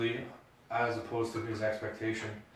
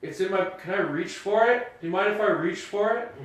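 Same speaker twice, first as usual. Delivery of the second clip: higher, louder, anxious politeness; nervous driver voice; 8 semitones higher, 5 dB louder than usual. Retold or imitated. Friend retelling his traffic stop, nervous higher voice. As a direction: ask the cop nervously. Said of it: "he goes".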